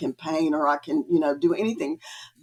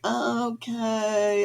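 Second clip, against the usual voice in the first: drawn-out, high, skeptical okay; doubtful; 4 semitones higher, 4 dB quieter than usual. Retold or imitated. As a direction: stretch it out as if humoring someone crazy. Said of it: "I left there thinking she was crazy".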